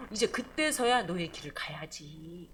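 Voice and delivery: God gently urges the recovered prophet on; gentle urging. Acted. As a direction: ease him onward, slowly. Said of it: "이렇게 재촉하시는 하나님".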